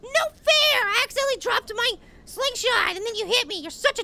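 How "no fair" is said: whiny, higher-pitched protest; protesting wail. Real, not acted. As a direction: wail it out like a sore loser. Loses round, protests loudly.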